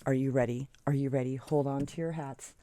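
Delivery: normal talking voice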